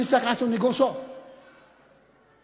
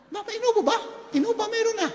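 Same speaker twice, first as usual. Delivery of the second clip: high-pitched, puzzled query; incredulous question; 12 semitones higher, faster than usual. Acted. Questioning the strange van brand, incredulous. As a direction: voice jumps up in disbelief.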